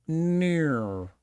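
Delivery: imitating a drawn-out Australian "No"; Australian accent. Australian learner answering his teacher in a video, exaggerated Australian accent. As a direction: stretch "No" into an Australian "naur". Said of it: "Australian person would go".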